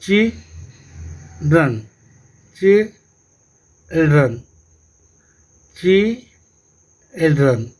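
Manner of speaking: चिल्ड्रन said slowly three times, each syllable drawn out; slow pronunciation drill. Shown as a demonstration. Teacher to pupils, modelling the digraph word, slow drilling. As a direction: spell out चिल्ड्रन for the class, syllable by syllable. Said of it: "The children are playing in the park".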